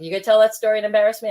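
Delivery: put-on mother teasing her child about an embarrassing story, fast and loud; mom teasing. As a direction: toss it off quick like a teasing mother. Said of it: "she's like".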